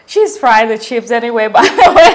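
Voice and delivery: loud laughing talk. Real, not acted. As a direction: laugh through the words. Laughing while talking.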